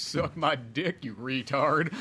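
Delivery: slow, drawled sneer; slow Southern drawl with a laugh at the end; Gump insult. Retold or imitated. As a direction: stretch the insult out lazily. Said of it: "talking in that accent".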